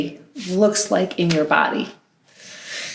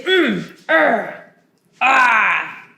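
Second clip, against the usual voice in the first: angry grumble; 3 semitones higher, much slower than usual. Shown as a demonstration. Teacher showing kids the sounds of anger, frustrated grunting. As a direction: huff and grumble like you're holding anger in. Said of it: "And I might say".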